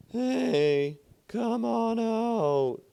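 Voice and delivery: high, loud call drawn out slowly; calling shout. Acted. A voice from the sky calling to the workman, a high slow loud shout. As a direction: call down from far above, stretching come on out. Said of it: "he heard a voice shout".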